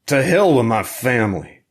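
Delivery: loud and high, angry outburst; a drugged smuggler shouting at his interrogator; shouted. A narrator's reading. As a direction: yell it in his face, furious. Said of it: "he shouted".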